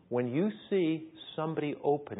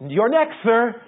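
higher and louder, brisk call; woman barber calling; 9 semitones higher, 13 dB louder, slower than usual. Retold or imitated. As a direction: call the next customer over. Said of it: "She comes over".

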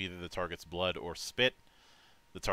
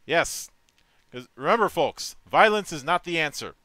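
loud mock announcement; hammed-up proclamation; 7 semitones higher, 9 dB louder than usual. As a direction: proclaim it like a showman. Mock speech to folks.

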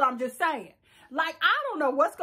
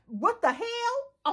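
shocked disbelief; 7 semitones higher, much slower than usual. Real, not acted. Shocked reaction to catheter story.

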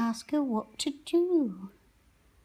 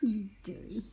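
ordinary talk

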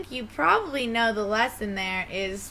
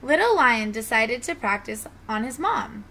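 ordinary delivery, nothing marked

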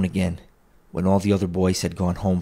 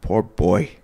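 a touch of pity for his younger self; mild sorrowful pity; 4 dB louder than usual. A narrator's reading. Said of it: "in pity for his former self".

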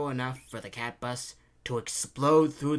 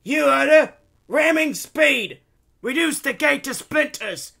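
very high, loud engineer's yell; bellowing; 14 dB louder than usual. A narrator's reading. Engineer driving the cat bus at the gate, bellowing. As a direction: bellow the command at full force. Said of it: "The engineer bells at the cat bus".

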